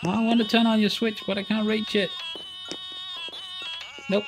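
ordinary delivery

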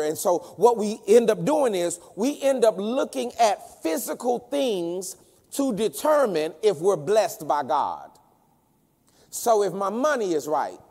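high, ringing pulpit delivery; forceful preaching. Real, not acted. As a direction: build it up, stretching the key words.

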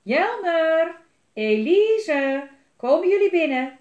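loud, high call across the garden; a mother shouting for her children at bedtime. A narrator's reading. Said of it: "klonk mama's stem door de tuin".

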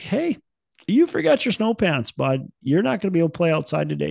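high, sing-song mom voice, then quick; a mother gently breaking bad news; wife to son. Wife telling son he forgot his snow pants, high mom voice. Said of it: "My wife's like".

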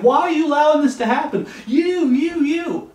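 high, loud blaming protest; hammed-up, indignant accusation; accusing God. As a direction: jab each "you" like a pointed finger. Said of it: "he's pointing at God saying".

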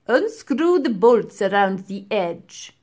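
put-on slow, loud commanding old man; demanding professor. A narrator's reading. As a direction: demand it sternly. Professor instructs.

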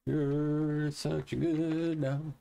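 slow high cooing at the dog; slow high dog praise. Real, not acted. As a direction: coo at a good dog slowly.

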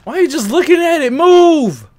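high, loud, frantic yelling; panicking gamer. Real, not acted. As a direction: yell at him to move, terrified. Urging a frozen character.

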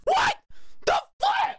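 very high startled cry; shrieking surprise; shocked squeal. Real, not acted. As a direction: yelp in disbelief. Sudden shock in game.

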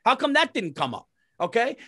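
high, rising indignant rant; retold anger. Husband recalling wife hid broken AC, indignant high rant. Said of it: "now I'm angry".

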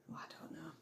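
barely audible whisper. Real, not acted. Unsure which dish to serve, whispering to herself. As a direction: breathe it out as a whisper to yourself. Muttering to herself over recipes.

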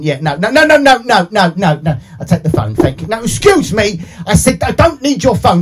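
ordinary talk, nothing marked

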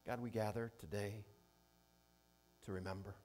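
earnest, measured prayer voice, softening at the ends; pastor's prayer. Acted. Pastor praying over the congregation, earnest and hushed.

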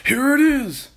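high, loud triumphant shout; excited cry. A narrator's reading. Mister Kilcranky rushing into the kitchen, excited cry. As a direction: call it out at full pitch. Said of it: "cried Mister Kilcranky".

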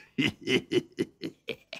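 breathy sly villain chuckle. A narrator's reading. Nogbad chuckling over his scheme. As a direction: chuckle to yourself, pleased. Villain laughs after boast.

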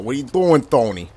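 ordinary delivery

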